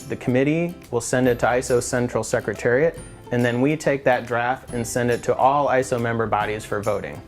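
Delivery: ordinary talk, nothing marked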